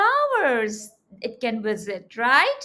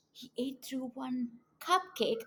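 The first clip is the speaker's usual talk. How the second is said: ordinary delivery, nothing marked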